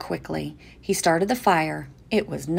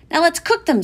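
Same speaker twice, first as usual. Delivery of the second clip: put-on child voice; 11 semitones higher, 8 dB louder than usual. A narrator's reading. Jimmy's child voice.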